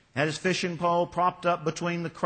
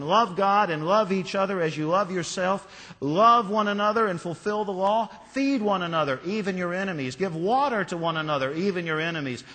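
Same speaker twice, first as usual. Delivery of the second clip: preaching Jesus' words; 4 semitones higher, 4 dB louder than usual. Acted. Quoting Jesus' teaching.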